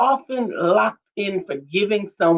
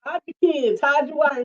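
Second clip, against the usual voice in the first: higher-pitched mock warning; imitating viral interviewee; 7 semitones higher than usual. Swapping viral quotes with co-host, higher-pitched mock alarm. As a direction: mimic a frantic warning, pitched up.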